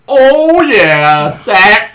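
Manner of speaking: loud, high fan holler; mock game-day cheering; rowdy football fan. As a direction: holler it from the stands. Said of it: "big fat guy in the stands".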